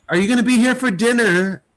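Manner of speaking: high-pitched imitation of a wife; voicing the wife. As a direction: lift the voice high to play the wife. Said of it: "she's like".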